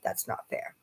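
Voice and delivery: under her breath. Real, not acted. Aside after mentioning the gift.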